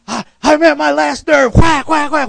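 high, loud mock frustration at last nerve; a parent losing it and smacking; exasperated parent. Preacher acts a fed-up parent shouting at the end of their rope. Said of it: "whatever you would do".

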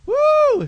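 loud high whoop of joy; excited preacher whoop. Real, not acted. Preacher whooping at the church in sheer excitement. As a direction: let out a big celebratory woo.

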